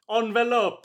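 envelope said ahn-veh-lope in a mock Italian accent. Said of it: "or as the Italians say".

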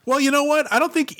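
high, mocking well you know what; sarcastic retort. Real, not acted. Cohost answering his friend, sarcastic and high. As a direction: push back with mock indignation.

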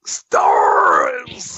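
high strained unvoiced monster shout; Nemesis impression. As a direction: screech it like a mutant. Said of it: "give me their best Nemesis impression".

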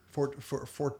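ordinary talk

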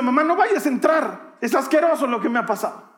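put-on high, urgent pleading as a boy; acted plea to keep someone out; distressed child. Boy warning his mother at the door, distressed pleading.

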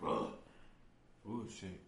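choking on a sip of water; sputtering, water down the wrong pipe; coughing up water. Real, not acted. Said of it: "That water went down the wrong way".

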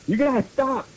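high-pitched mock angry shout; mild read-aloud outburst. A narrator's reading. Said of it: "I shooted angrily".

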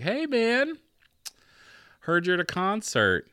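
hammed-up fake-friendly caller; mock casual minister. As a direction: senior minister calling Roy, mock breezy and high. Retold call from senior minister.

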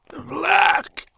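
strangled, dry corpse croak. Acted. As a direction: choke the word out, dry. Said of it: "in a strangled, dry voice".

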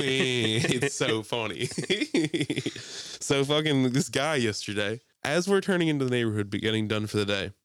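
normal talking voice

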